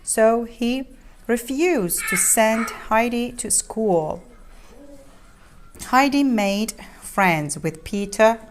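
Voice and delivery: ordinary delivery, nothing marked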